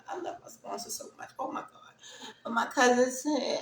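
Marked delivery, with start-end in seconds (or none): 0.1-1.7 fast, soft breathless whisper of love; choked-up whisper; whispering through emotion; emotional whisper. Real, not acted. Overwhelmed, whispering.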